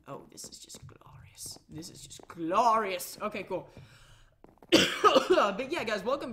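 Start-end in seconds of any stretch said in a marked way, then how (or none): 0.1-1.6 awed whisper. Real, not acted. Admiring his staircase. 1.7-3.2 grand drawn-out praise. Pretend. Admiring his staircase.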